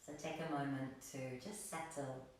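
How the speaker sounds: soft, soothing meditation voice with long, restful pauses; guided meditation. Real, not acted. Yoga teacher inviting gratitude into the practice, soft guided meditation. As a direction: settle them into stillness, quietly and without hurry.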